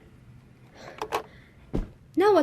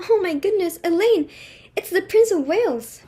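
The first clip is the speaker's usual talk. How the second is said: astonished realization; 5 semitones higher, 5 dB louder than usual. Acted. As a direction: gasp it out as the truth dawns, higher and louder. Hostess realizes the Prince is here.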